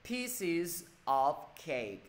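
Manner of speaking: very slow "Pieces of cake", each word apart; unlinked, word by word. Shown as a demonstration. Teacher helping students who cannot link yet, slow separated words. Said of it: "ai chưa đọc nối được thì cố gắng đọc là".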